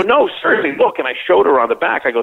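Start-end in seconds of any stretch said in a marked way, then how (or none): 0.0-1.0 high-pitched, insistent appeal; retold earnest protest. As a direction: plead that you really mean it. Retells protesting to his girlfriend.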